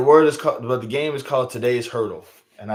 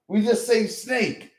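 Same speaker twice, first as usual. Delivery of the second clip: slow exasperated; 8 semitones higher, slower than usual. Real, not acted. Exasperated southern complaint.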